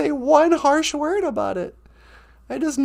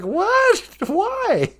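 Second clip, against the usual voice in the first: grandma surprised; 7 semitones higher than usual. Retold or imitated. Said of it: "She was like".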